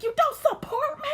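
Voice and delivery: imitating Carly's teary meltdown, shouting and sobbing high; mock crying outburst. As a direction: wail it like a hammed-up breakdown. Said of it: "Carly's crackhead genes was kicking in".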